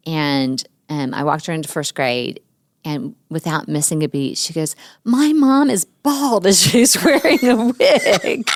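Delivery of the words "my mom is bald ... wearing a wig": put-on high, loud, slow child's announcement, laughing; little girl announcing. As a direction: blurt it out proudly like a six-year-old. Daughter announces at school.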